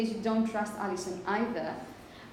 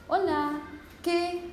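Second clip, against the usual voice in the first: higher-pitched, careful learner's Spanish with aspirated consonants; foreign learner's Spanish; 6 semitones higher, slower than usual. Retold or imitated. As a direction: imitate a foreigner trying basic Spanish. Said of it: "when Charlie was speaking Spanish".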